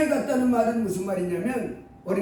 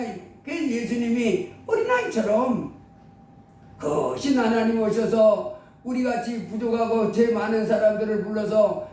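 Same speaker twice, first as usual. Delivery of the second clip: ordinary delivery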